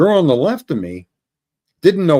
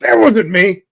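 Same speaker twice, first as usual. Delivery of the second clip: raised, loud protest of innocence; defensive denial; 6 semitones higher, 7 dB louder, slower than usual. Retold or imitated. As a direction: blurt it out to deny the blame. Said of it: "And he goes".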